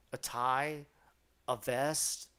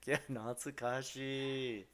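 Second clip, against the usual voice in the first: ordinary delivery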